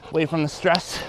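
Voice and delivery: ordinary talk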